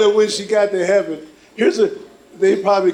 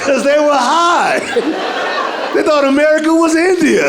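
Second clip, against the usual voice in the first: high, loud laughing delivery; laughing punchline; 10 semitones higher, 4 dB louder than usual. Real, not acted. Comic cracking himself up at his own joke, laughing. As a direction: laugh your way through the punchline.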